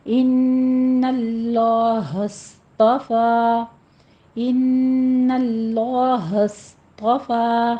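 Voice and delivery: very slow ان اللہ اصطفیٰ with nasal غنہ and heavy اللہ; tajweed demo. Shown as a demonstration. Teacher models غنہ slowly, twice. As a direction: hum the nun of ان, stretch it. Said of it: "ان میں غنہ واجبہ کریں گے".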